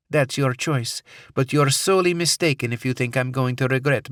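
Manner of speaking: put-on firm, high Middle Eastern accent; resolute woman, Middle Eastern accent. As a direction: certain of her justice. Captor asserts resolve.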